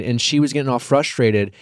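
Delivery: ordinary talk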